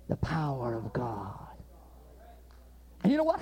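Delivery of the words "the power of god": hushed, slow whisper. Real, not acted. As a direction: breathe it out in awe. Quiet awe over healing story.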